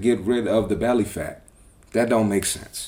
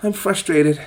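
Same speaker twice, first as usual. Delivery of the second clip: higher, put-on sulky young woman voice; mocking the client; 8 semitones higher, slower than usual. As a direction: pitch it up and whine her line. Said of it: "She's like".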